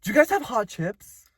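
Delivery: high, clueless customer voice; lightly voiced dumb customer; mock customer. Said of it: "people who have come in".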